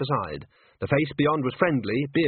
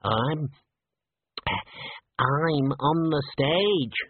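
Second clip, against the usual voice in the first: halting, very slow squeaky man; hesitate, then draw it out in a slightly squeaky voice; 2 semitones higher, much slower than usual. A narrator's reading. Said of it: "in a squeaky voice".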